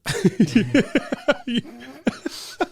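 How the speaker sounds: high, long burst of laughter; laughing. Real, not acted. Storyteller amused by the retold phone call, laughing. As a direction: crack up at your own story.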